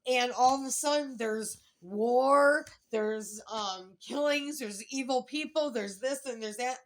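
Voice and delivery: slowing on war, piling up the horrors; retold outrage. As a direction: build it up like a preacher's list of woes. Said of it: "he goes".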